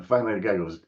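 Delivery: usual speaking voice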